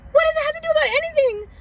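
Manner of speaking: high, fast panicked squeal; mock terror of getting caught; frightened young girl in the club; scared. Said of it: "I'm scared".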